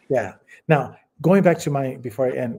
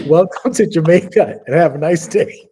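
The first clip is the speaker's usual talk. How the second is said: Jamaican greeting, louder and higher; punchline voice; 4 semitones higher, 9 dB louder than usual. Retold or imitated. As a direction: deliver it like a tourist slogan. Penis tattoo reads punchline.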